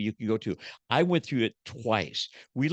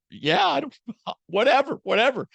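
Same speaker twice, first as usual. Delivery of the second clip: high, louder, laughing whatever; mock apathetic self; 12 semitones higher, 5 dB louder than usual. Husband brushing off his wife, high laughing whatever. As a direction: shrug it off in a silly high voice.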